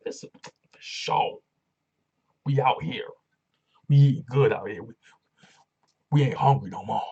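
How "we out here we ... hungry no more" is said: louder boastful brag; proud hype. Real, not acted. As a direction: shout it like a winner. Hyping fan boast.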